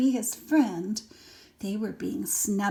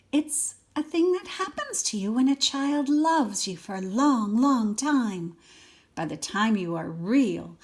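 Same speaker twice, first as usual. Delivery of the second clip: put-on lively read-aloud for a small child; expressive storybook reading; story narration; 2 semitones higher, 7 dB louder than usual. A narrator's reading. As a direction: read it like a bedtime picture book. Reads picture book to child.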